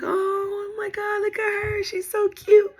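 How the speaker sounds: high, slow cooing over a newborn; gushing nurses. Retold or imitated. Nurses cooing at the newborn baby girl in a high, gushing voice.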